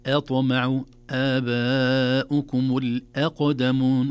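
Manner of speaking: أطمع آباؤكم الأقدمون recited slowly with a light hamza; correct recitation. Shown as a demonstration. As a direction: keep the hamza in الأقدمون light, not darkened by the qaf. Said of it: "وليس الأقدمون".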